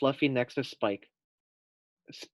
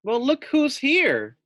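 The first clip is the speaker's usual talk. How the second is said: high, slow, hearty greeting; a big cheerful firefighter hello; drawn-out friendly call; firefighter Tom; 13 semitones higher, 6 dB louder, slower than usual. Acted.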